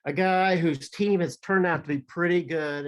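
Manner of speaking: ordinary delivery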